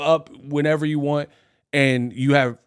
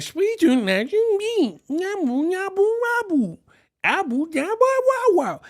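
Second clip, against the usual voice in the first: put-on very high babbling baby voice; baby babble. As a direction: coo nonsense like a toddler. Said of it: "they don't know how to speak".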